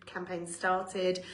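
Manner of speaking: ordinary talk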